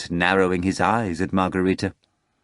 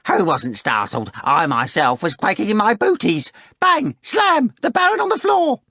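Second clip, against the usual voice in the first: high, building whiny wail up to a shrill cry; a jester whining, then wailing it out; 13 semitones higher, 5 dB louder than usual. Acted. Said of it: "began to wail and whine".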